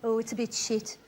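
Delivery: glum shrug. Retold or imitated. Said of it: "And they all go".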